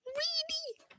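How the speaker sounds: "Really?" squeaked very high and soft; squeaky disbelief. Pretend.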